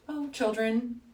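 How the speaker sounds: a touch of quiet deadpan; understated, flat-faced reply; completely serious. Retold or imitated. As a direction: drop it soft and matter-of-fact. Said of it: "Completely serious".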